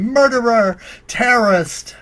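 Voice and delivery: loud, high accusing shout; mock screaming. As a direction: imitate an angry crowd yelling accusations. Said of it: "a crowd of people chasing you down the grocery aisle screaming".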